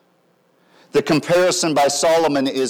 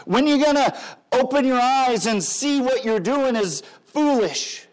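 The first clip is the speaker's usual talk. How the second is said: higher-pitched, urgent street-corner appeal; Wisdom calling out; 8 semitones higher than usual. Acted. Wisdom to the naive in the streets, calling out. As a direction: call out to rouse the unheeding. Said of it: "She's out saying".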